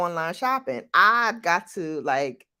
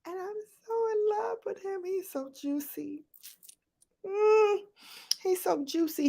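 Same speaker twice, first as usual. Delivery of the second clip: gushing grandma; 5 dB quieter than usual. Real, not acted.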